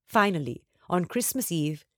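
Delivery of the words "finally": ordinary delivery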